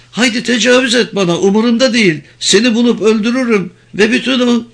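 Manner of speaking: high, heated captive woman's voice; furious high-pitched defiance; defiant fury. A narrator's reading. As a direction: spit out threats in a high voice. Captive defies and threatens the officer.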